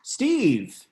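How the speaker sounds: high-pitched call, falling away. Real, not acted. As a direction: call for the next guest by name. Host calling next guest in.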